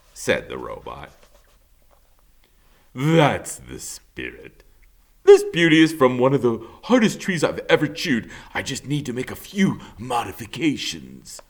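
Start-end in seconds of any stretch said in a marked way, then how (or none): 2.9-11.4 high, bouncy beaver voice, slowing at the end; a confident helper taking charge; cheerful beaver. Acted. Mister Beaver reassuring the robot, high and bouncy.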